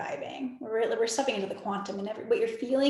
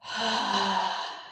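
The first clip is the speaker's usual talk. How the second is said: long breathy exhale; sigh; 2 semitones lower than usual. Real, not acted. Meditation guide releasing a breath, long sigh. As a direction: let out a long, airy sigh.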